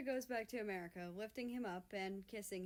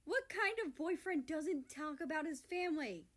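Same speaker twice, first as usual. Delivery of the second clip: high, louder indignant exclaim; 8 semitones higher, 5 dB louder than usual. A narrator's reading. Germany scolding his brother, loud indignant exclaim. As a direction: scold him for keeping family secret. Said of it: "Germany exclaims".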